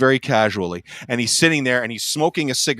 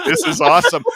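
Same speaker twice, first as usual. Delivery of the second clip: higher, gleeful retold aside; gleeful grin; 4 semitones higher, 4 dB louder than usual. Fan thrilled by the singer's death stares, gleeful grin. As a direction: say it through a huge grin. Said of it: "with this big shit-eating grin on my face".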